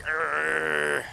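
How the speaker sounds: loud strained growl, pushing hard; straining grunt. Retold or imitated. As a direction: grunt like you're straining with effort. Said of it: "get up close to you and be like".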